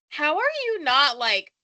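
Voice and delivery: loud, disbelieving what the fuck; incredulous outburst. Retold or imitated. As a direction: shout out in disbelief. Retold outrage at interviewer.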